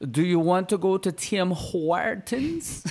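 put-on slow, odd-accented invitation, foreign to the speaker; a friend's odd accent asking about Tim Hortons; strange foreign accent. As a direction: imitate a hard-to-place foreign accent, drawn out. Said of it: "he had that strangest accent".

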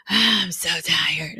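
slow, dragged-out weary voice; drained drawl. Retold or imitated. Tired client dragging out her complaint. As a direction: stretch each word like she can barely talk.

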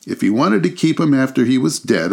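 high, scornful; scornful charwoman. A narrator's reading. Charwoman scorning Scrooge, high and scornful. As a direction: sneer at the dead man. Woman scorns dead man.

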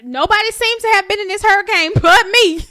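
loud, high, laughing protest; laughing complaint. Real, not acted. Friend griping about the hurricane hitting only her, laughing loudly.

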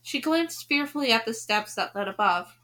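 usual speaking voice

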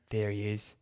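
low, quiet creepy Englishman. Retold or imitated. Pursuer spotting him in the bathroom, mock creepy English voice. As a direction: imitate a sinister little English stalker. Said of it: "in this creepy little English voice".

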